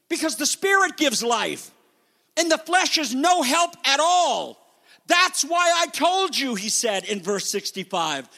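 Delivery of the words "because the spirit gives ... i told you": high, emphatic preacher's climax; forceful preaching. Real, not acted. Preacher explaining to unbelievers why they don't believe, forceful preaching. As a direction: punch out the verse from the pulpit.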